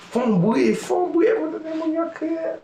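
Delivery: very high-pitched crowd-rousing call; party emcee's cry. Acted. Emcee telling the party to make noise, high holler. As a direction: pitch it way up like a hype man. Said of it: "ou tande animatè a di".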